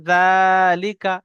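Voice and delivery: জালিকা with long stretched জা, very slow; slow drawn recitation. Shown as a demonstration. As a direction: recite জালিকা slowly, holding the first aa. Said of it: "উদাহরণস্বরূপ বা যেমন".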